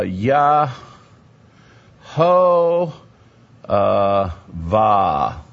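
Yah-ho-va in long, separated syllables. Shown as a demonstration. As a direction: draw out Yah, ho, va with big pauses. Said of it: "make this vowel to be the O sound".

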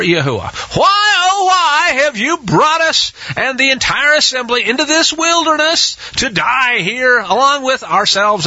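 high, wailing mock whine of grumbling crowd; whining complaint. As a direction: wail it like whiny complaining people. People whining against Moshe.

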